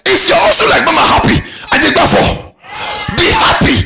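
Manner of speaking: loud, high, driving sermon delivery; shouted, rousing preacher's rant; full-force preaching. Real, not acted. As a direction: preach it hard to a whole hall.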